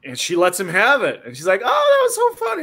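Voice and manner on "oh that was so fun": much higher squeal of delight; hammed-up giddy girl. Storyteller voicing the playmate after the kick, squealing.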